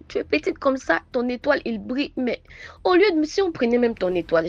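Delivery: high, loud, rushed lament; retold cry of a stranger over a sick girl; exclaiming woman. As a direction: cry out over the child in pity. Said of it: "elle s'écrie".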